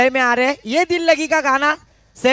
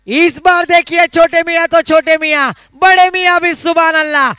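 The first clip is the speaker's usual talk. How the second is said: excited boundary call; 3 semitones higher, 5 dB louder than usual. Real, not acted. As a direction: roar it, then stretch the last word. Commentator calls final boundary four. (जोशीली अंतिम चौके की commentary)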